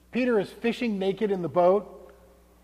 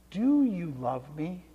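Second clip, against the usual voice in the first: slow and softer, drawn-out question; slow, soft third asking; 6 dB quieter, slower than usual. Acted. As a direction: ask it slowly and softly, the third time. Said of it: "The third time".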